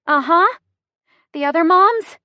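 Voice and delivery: high, loud, strained fragments; breathing through contraction. A narrator's reading. Chrissy in labor complains to the driver, strained. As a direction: force each phrase out between breaths. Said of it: "Deep breath".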